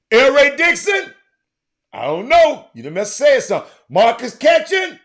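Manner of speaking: loud high shout calling out names. Real, not acted. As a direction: call them out across the room.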